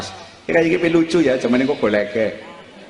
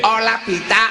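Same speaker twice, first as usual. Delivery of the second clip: lightly acted angel, pitched up; a hint of a higher angel voice; angel's answer; 9 semitones higher, 3 dB quieter than usual. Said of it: "Malaikate jawabe".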